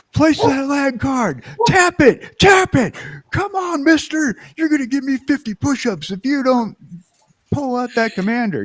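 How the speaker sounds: drill instructor yell. Pretend. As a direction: bark orders like a drill sergeant. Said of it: "yell at somebody and go".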